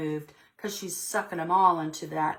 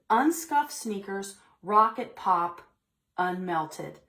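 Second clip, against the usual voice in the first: put-on expressive read-aloud to children; storybook reading voice; story narration; 3 semitones higher, 4 dB louder than usual. A narrator's reading. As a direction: read it like story time for little kids. Teacher reads picture book aloud.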